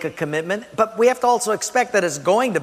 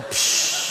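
long "psh" of air escaping; deflating hiss; 3 dB quieter than usual. Acted. Preacher showing a new believer's excitement deflating, hissed. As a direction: hiss like excitement draining away. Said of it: "your excitement goes".

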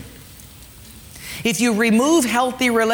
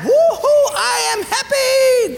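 shrieking high with delight, the happy slowly drawn out; overjoyed whoop; 4 dB louder, slower than usual. Acted. The man given mansions whoops to his benefactor, thrilled and high. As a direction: squeal like someone handed a fortune. Acted rich gift recipient.

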